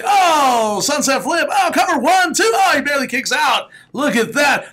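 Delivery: loud, high, hyped ringside commentary; play-by-play call. Shown as a demonstration. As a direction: call the match like a wrestling announcer gone wild. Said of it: "So when you say".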